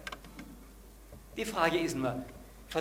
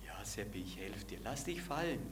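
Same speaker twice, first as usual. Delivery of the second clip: calm voice of God; 6 semitones lower, 8 dB quieter than usual. Acted. God answers calmly.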